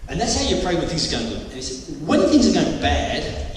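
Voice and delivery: usual speaking voice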